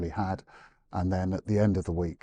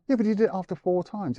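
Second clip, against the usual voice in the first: indignant retort; 11 semitones higher than usual. Retold or imitated. Trainer to owner about the treat, an incredulous high scolding. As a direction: act out telling the owner off in disbelief.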